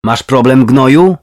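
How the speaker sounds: loud, rising aggressive challenge; shouting. A narrator's reading. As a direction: pick a fight at full voice. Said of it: "krzyknęła".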